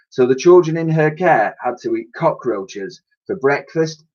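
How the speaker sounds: ordinary delivery, nothing marked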